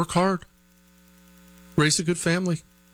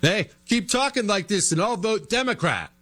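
mock threat; 9 semitones higher than usual. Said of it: "that whole threatening thing".

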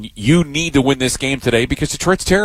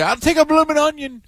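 much higher, hammed-up Aussie restaurant order; mock Australian accent; 13 semitones higher than usual. Joking about accent contest entrants, mock Australian accent. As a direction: put on a joke Australian accent, pitched way up. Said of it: "the best Australian accent".

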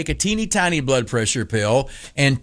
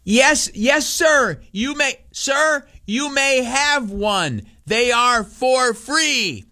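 much higher, slow patronizing drawl; condescending clerk; 10 semitones higher, much slower than usual. Retold or imitated. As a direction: talk to him like he's senile. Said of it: "you know how they do".

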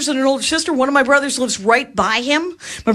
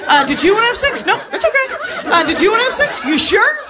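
higher, hopeful, repeated ask; hopeful guy asking; 6 semitones higher than usual. Retold or imitated. To the audience, a man who keeps hopefully asking. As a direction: keep asking, brushing off each no. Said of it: "My impression of ninety-eight percent of the men".

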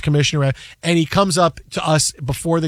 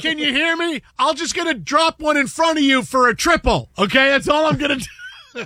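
high-pitched call to the outfielder; playful taunt, pitched well up; mock taunting; 8 semitones higher than usual. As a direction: re-enact cocky calling across the field.

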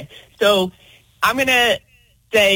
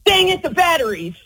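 higher, exasperated little groan of a line; frustrated gift opener; 7 semitones higher than usual. Shown as a demonstration. Caller shows the host how she wants it said. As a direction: sound annoyed at the missing batteries. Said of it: "what do you say and how do you say it".

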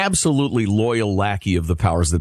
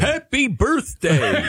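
high, slow cartoon cheer; hammed-up snowman greeting; Frosty the Snowman; 11 semitones higher, much slower than usual. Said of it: "he sounds like Frosty the Snowman".

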